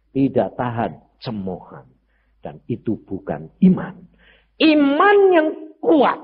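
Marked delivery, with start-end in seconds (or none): 4.6-6.2 preaching emphasis. Acted.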